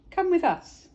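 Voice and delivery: high loud call. Acted. Character calling friends aboard, loud and high. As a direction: sing out the invitation.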